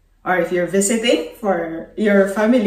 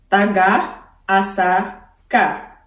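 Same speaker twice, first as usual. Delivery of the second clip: slow for learners; slower than usual. Shown as a demonstration. Slow repeat for learners to copy.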